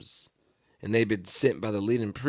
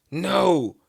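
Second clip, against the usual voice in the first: loud, high, drawn-out refusal; firm, raised-voice protest; emphatic; 7 semitones higher, 6 dB louder than usual. Retold or imitated. Peter answers Jesus's prediction, emphatic. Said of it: "Peter declared emphatically".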